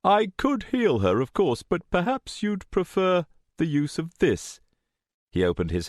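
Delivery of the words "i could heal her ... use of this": high, airy, polite voice; lifted, insinuating offer; tempting villain. A narrator's reading. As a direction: dangle the offer with smooth courtesy. Villain tempts her with crystal.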